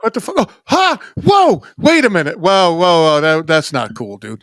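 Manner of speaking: high yelping alarmed whoa; high-pitched startled disbelief; mock shock. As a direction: play it like a startled guy backing off. Acting out shock at story.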